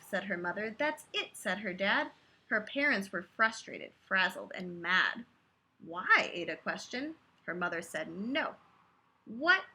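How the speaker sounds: rhythmic read-aloud of a rhyming book; lilting bedtime-story voice; story narration. A narrator's reading. Reading a picture book aloud.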